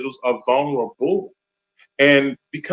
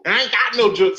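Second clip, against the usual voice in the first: laughing through the words; 7 semitones higher, much faster than usual. Real, not acted. Laughing at own joke.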